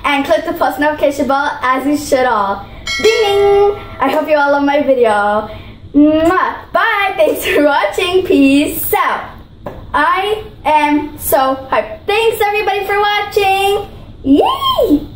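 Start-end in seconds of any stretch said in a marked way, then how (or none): 3.0-3.8 ding ding like a bell; playful notification bell sound; bell imitation. 5.9-6.7 smack a kiss to the viewers; blown kiss. Real, not acted. 14.2-15.1 much higher happy yay; cheering goodbye to viewers; excited cheer. Real, not acted. Cheers at end of video.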